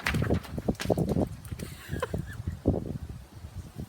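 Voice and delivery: breathy unvoiced laughter; real laugh. Real, not acted. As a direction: laugh as the page flies off. Paper blows away outdoors.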